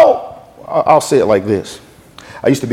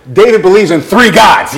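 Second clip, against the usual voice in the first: raised, high teasing announcement; calling out; 6 semitones higher, 4 dB louder than usual. Retold or imitated. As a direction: announce it across the store. Store owners call out a taunt.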